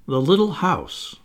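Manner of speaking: ordinary delivery, nothing marked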